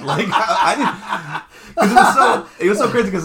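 laughing disbelief. Real, not acted. Laughing at how rude the sponsor sounded. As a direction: laugh through the words in disbelief.

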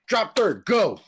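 high, loud urgent call. Retold or imitated. Coach calling to his runner to take off. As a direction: yell the runner off. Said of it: "And I was like".

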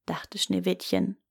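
ordinary delivery, nothing marked

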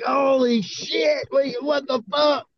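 freaking out. Retold or imitated. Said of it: "like, freaking out".

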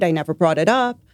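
normal talking voice

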